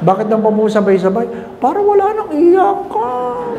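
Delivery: lightly mocking grieving relative; high mourner voice.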